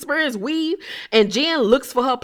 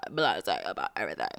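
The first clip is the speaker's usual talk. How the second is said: drowsy blasé Meredith; 13 dB quieter, faster than usual. Retold or imitated. Mocking the drowsy castmate: low, quiet blasé murmur. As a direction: sink into a bored half-asleep murmur. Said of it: "act like she is in a permanent state of being drowsy".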